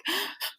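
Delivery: high breathy gasp; crying gasp before saying she's pregnant. Retold or imitated. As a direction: catch the breath before speaking. Retelling crying announcement.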